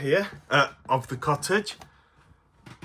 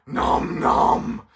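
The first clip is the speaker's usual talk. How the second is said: munching creature voice; 5 semitones higher than usual. Acted. Puppet Stripe answers.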